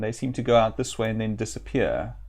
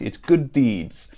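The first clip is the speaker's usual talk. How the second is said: usual speaking voice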